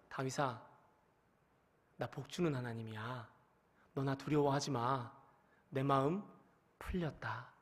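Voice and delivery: soft, low and tender, as God reassuring David; God's gentle voice. Acted. God telling David to stop fearing him, soft and low.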